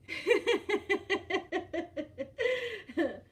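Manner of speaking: long, high giggling fit; real rolling laughter; amused laugh. Real, not acted. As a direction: giggle helplessly for a few seconds. Laughs reading chat.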